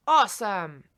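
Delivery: loud, punchy power word; emphatic exclamation. Shown as a demonstration. Coach demonstrating a word to say for the circle, emphatic exclamation. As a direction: call it out like a personal rallying word.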